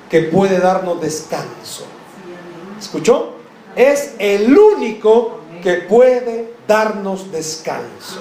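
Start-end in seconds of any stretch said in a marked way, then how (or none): 3.7-8.2 slow emphasis. Acted. Preacher repeats key line.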